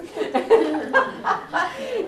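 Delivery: loud high burst of laughter; laughing. Real, not acted. Teacher recalling a scary mentor, laughing out loud. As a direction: break into laughing at the memory.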